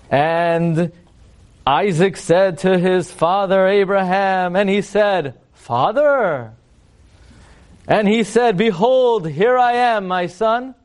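mock-grand, drawn-out scripture reading; Masterpiece Theater voice. Rabbi mocking the stilted dialogue of father and son, theatrical. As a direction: declaim each phrase with stagey solemnity. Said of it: "What is this, Masterpiece Theater over here?".

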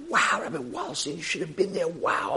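soft, hushed mock awe; gushing girls. Girls gushing to the rabbi about a lecture, mock awe.

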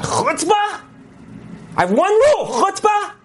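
high, explosive mock outburst; outraged teacher's yell. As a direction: yell it like a teacher laying down the law. Retold rabbi erupts at rude boy.

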